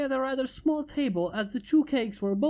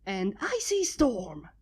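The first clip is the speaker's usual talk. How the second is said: slow, proud name announcement; dramatic heroine; slower than usual. A narrator's reading. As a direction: announce yourself theatrically.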